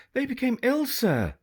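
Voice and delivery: put-on girl faking an English upper-class accent to a pirate. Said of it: "in her best English upper-class accent".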